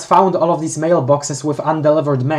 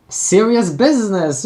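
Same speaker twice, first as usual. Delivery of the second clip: mock serious; 6 semitones higher than usual. Mock grave remark.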